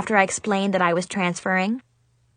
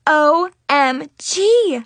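slow, drawn-out, louder cry of dismay; cried out; 5 semitones higher, 5 dB louder, much slower than usual. A narrator's reading. Girl discovering her wallet is missing, crying out slowly. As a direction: spell it out in slow horror. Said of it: "I finally cried".

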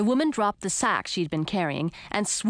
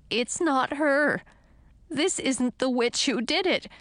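high, drawn-out dread; horrified; 5 semitones higher than usual. A narrator's reading. Frog prince realising it's the wrong witch, horrified. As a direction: break the bad news with alarm. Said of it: "whispered in a horrified voice".

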